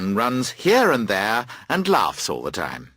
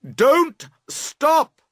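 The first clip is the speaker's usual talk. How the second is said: high, loud call across the water; much higher and louder, calling to the girls on the bank; shouting; 4 dB louder than usual. A narrator's reading. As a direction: rower shouting to the towing girls. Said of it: "you cry loudly".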